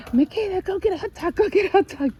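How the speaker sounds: put-on high, hurried calling out to a child; urgent call. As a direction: shout the errand up high and urgent. Calling granddaughter to fetch bait.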